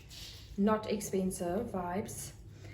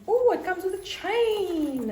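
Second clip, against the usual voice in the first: surprised; 9 semitones higher, 5 dB louder than usual. Real, not acted. Discovers chain.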